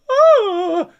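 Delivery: high, loud squealing yawn sound; imitated dog yawn. Imitating how his dog yawns, a high loud yelp. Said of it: "he goes".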